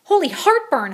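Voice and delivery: high, loud startled holler. A narrator's reading. Scopito startled by the growl, high holler. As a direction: cry out in alarm. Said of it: "hollered Scopito".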